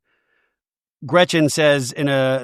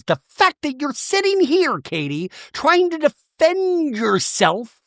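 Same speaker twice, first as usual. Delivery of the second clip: high, slow indignation; mock indignant Shannon; 10 semitones higher, slower than usual. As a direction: drag the scolding out high. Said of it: "Shannon goes".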